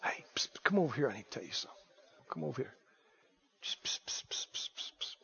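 hushed gossip whisper; psst, lean in and whisper; stage whisper. Pretend. Preacher acts out a gossiping whisperer.

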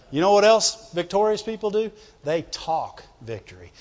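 normal talking voice

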